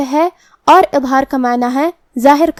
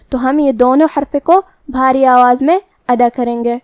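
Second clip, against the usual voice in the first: normal talking voice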